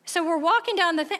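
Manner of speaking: ordinary talk, nothing marked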